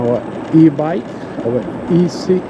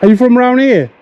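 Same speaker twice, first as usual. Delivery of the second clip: calling out; 10 semitones higher, faster than usual. Real, not acted. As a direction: call out to a passerby. Greeting a stranger from scooter.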